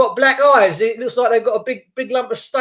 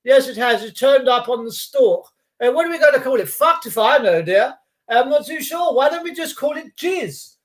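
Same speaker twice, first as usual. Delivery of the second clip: hammed-up posh new parent, higher pitched; mock posh chat, lifted in pitch; 3 semitones higher than usual. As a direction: play a well-to-do couple naming the baby. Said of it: "just posh people do".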